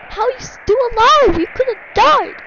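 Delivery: high, loud disbelief; shocked outcry. Real, not acted. Player to someone who nearly died, shocked shouting. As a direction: cry out in alarmed amazement.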